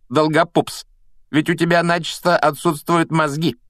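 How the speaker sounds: high-pitched, taunting mock explanation; jeering mockery. A narrator's reading. Malfoy mocks orphans, paupers and brainless Neville, jeering. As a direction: needle them one insult after another. Malfoy goes on insulting the team.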